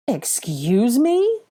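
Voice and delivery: high, loud, very slow indignant question; can't believe what he's hearing; outraged demand. A narrator's reading. Malfoy told he is part of an investigation, outraged. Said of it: "he demanded in outrage".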